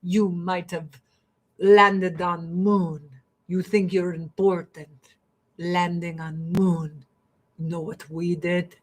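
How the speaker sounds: Russian guide boasting about vodka, in a put-on Russian accent. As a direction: play the proud Russian guide in a thick Russian accent. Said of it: "they're like".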